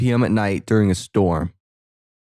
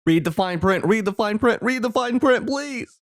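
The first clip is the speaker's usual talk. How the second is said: rising plea; 11 semitones higher than usual. Acted. Viewer begging the character to read the contract, rising higher. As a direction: climb in pitch with every repeat. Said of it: "building repeated plea".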